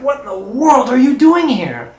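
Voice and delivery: loud, high mock call of disbelief; astonished fisherman. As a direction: call out amazed, as to a stranger at sea. Astonished fisherman spots him at sea.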